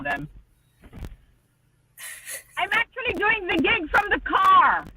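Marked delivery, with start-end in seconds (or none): 2.6-4.8 real high-pitched call to people nearby. Real, not acted. Telling people nearby about the gig from the car, calling out. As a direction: pitch up and project to someone outside.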